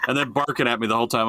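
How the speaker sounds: ordinary delivery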